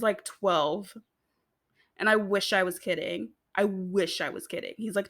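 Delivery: ordinary delivery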